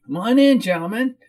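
high-pitched whiny greeting; whiny woman. Acted. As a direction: whine a welcome at your guests. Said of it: "she said in a whiny voice".

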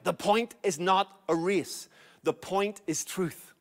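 slow, deliberate emphasis; slowed preacher's insistence; slow emphatic point. Real, not acted. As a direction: spell out each word slowly. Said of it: "preacher slows for emphasis".

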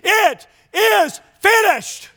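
high, slow, strong proclamation; triumphant, deliberate quoted declaration; victorious voice. Retold or imitated. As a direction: declare it in full control, word by word. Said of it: "he said in a victorious voice".

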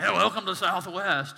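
put-on loud, sloppy drunk greeting at the door; hammed-up drunk welcome; drunk greeter. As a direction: slur it out like a tipsy usher. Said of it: "the guy at the door that's greeting you's drunk".

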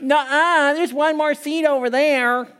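high-pitched tattling schoolkid voice; loudmouth kid. Acted. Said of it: "loudmouth kid".

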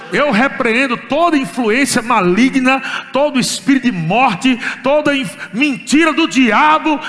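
loud, slow commanding rebuke; forceful rebuke. Retold or imitated. Father rebuking the devil over his daughter, loud and commanding. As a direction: rebuke the devil with full authority.

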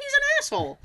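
mock complaint, squeaked up high; high indignation. Telling the older lady about the man, high indignation. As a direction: say it high-pitched and aggrieved.

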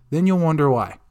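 normal talking voice

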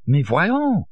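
French stranger protesting, high and shrill; French accent, shrill. A narrator's reading. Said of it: "thick French accent and a shrill".

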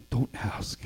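glum whisper. Acted. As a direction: brush the question off in a defeated whisper. Simon, no fish caught.

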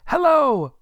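put-on very high, loud boyish hello; boy calling back. Boy in the street to Scrooge above, high shouted reply. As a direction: shout a greeting up. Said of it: "returned the boy".

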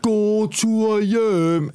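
stretched high taunt from the crowd; mock heckler yell. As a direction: imitate a drunk heckler calling out. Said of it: "Som råber".

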